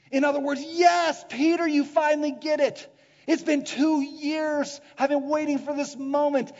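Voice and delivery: very loud, high, celebrating; jubilant proclamation. Acted. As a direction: exclaim in delight that they finally understand. Jesus thrilled Peter finally gets it.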